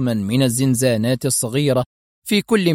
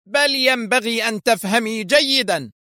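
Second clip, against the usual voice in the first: much higher, harsh retort; rough shout; 10 semitones higher than usual. A narrator's reading. Assistant shouting roughly at the mocking Mona. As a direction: snap back at an insolent prisoner. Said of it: "صاحت بها المساعدة في غلظة".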